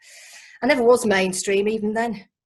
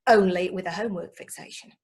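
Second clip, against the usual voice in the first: normal talking voice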